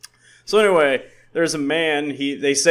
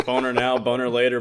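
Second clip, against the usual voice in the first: ordinary talk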